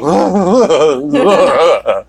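wordless wobbly moan, mimicking an actress falling apart; Cate Blanchett unraveling groan. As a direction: make a hammed-up frazzled noise instead of words. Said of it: "that's how I'm trying to communicate through audio how she's doing".

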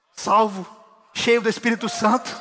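loud, high sermon voice, Aleluia drawn out slow; full-force preaching. Acted. Pastor preaching a convert's testimony to the congregation, loud and high. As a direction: proclaim the testimony to the church.

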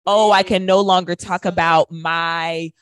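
usual speaking voice

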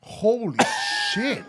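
a high, startled holy shit at the harsh smell; shocked exclamation. Real, not acted. Friend sniffs harsh whiskey and yelps in shock, high and startled. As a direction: recoil from the whiskey fumes with a laugh.

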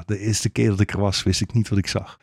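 usual speaking voice

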